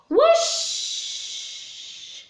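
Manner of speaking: a sudden high breathy whoosh of a flying saucer; high airy whoosh. Acted. Teacher making the saucer fly away for the kids. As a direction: whoosh it up like a rocket taking off. Rocket sound in song.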